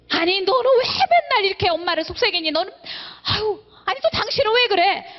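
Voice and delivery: a hammed-up exasperated parent scolding; nagging complaint. Preacher mimics a fault-finding believer, whining and scolding. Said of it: "사람 볼 때마다".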